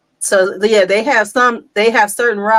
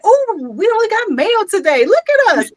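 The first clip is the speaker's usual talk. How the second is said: high, excited retold exclamation; excited boast; 12 semitones higher than usual. Woman retelling a boast to her mailman, excited. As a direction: play up the delight, pitch way up.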